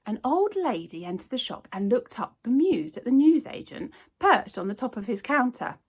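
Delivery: ordinary delivery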